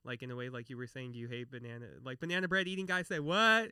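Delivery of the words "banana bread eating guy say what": higher, louder mock-shocked catchphrase; catchphrase imitation. Repeating someone's shocking remark back, catchphrase imitation. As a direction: fire it back as a borrowed catchphrase. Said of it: "repeat what they said".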